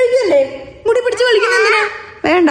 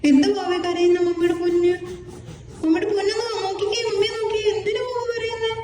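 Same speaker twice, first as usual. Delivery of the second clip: tender coaxing; 10 dB quieter than usual. Real, not acted. Mother comforting her crying child, tender coaxing. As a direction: gently coax a tearful little one.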